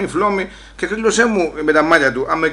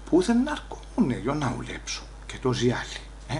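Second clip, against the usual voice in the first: inner voice; 11 dB quieter than usual. Retold or imitated. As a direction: mutter it to yourself, hushed. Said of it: "λέει από μέσα μου".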